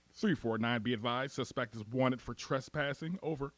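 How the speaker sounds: low, soft dispatch readout; radio dispatcher. Acted. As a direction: read it out like police radio. Police radio dispatch call.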